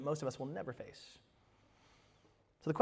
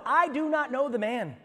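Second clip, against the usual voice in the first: high, loud, slower oath of denial; emphatic denial; 10 semitones higher, 8 dB louder than usual. Acted. Peter denying Jesus to the bystanders, emphatic oath. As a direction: swear it forcefully, raised and slow. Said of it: "again he denied it with an oath".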